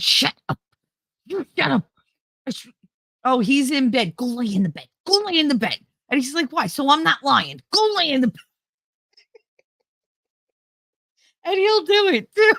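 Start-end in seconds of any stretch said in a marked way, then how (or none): 0.0-8.4 bossy mom whisper-hiss. Retold or imitated. Mom bossing son in retold scene.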